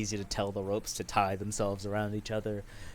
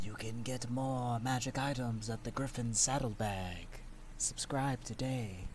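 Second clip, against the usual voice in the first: ad read; 6 dB quieter than usual. Acted. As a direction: read it like a sponsor plug. GM does an ad read.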